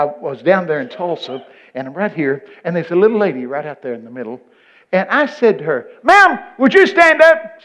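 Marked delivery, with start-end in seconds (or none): 6.0-7.6 slow, high, loud call across the hall; re-enacted loud, drawn-out summons. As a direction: holler to someone far back in the crowd. Retells calling out to a lady.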